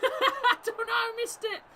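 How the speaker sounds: high, laughing through the words; giggling in disbelief; real laughter repeating the line. Real, not acted. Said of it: "I shouldn't be laughing".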